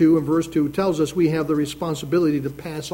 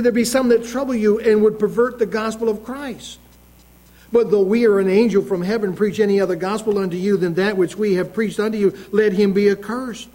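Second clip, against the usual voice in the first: raised preaching read of Galatians, a touch higher; scripture reading; 3 semitones higher than usual. Acted. Preacher reading Galatians aloud to his congregation, proclaiming. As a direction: proclaim the verse like a preacher at the pulpit.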